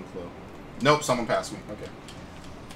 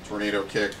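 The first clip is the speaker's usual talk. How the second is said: ordinary delivery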